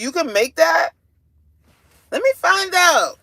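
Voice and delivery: high, loud incredulous teasing; playful amazement. Real, not acted. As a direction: tease them in loud, high disbelief. Amazed a chatter can cook.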